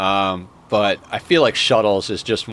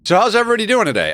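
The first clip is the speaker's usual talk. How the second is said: much higher, fast, bubbly hello; cheery greeting; 12 semitones higher, 4 dB louder, much faster than usual. Acted. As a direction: greet the viewers brightly.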